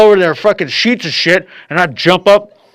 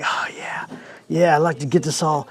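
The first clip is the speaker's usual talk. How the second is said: softer, breathy come-on; seductive whisper; 8 dB quieter than usual. Retold or imitated. Woman teasing the man in the movie, soft seductive whisper. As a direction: purr it to lure him in.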